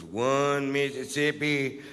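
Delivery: slow, drawn-out counting of a dim cop; plodding, dim-witted count; mock slow counting.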